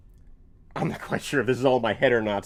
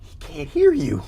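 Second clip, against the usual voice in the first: exasperated; 14 semitones higher, 6 dB louder, slower than usual. Acted. Realizing, exasperated.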